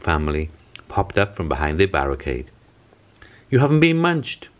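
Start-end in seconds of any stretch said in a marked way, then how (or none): 3.5-4.5 high, louder happy exclamation; mock delighted mother; relieved cry. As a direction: burst out with relief. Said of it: "cried Missus Hope".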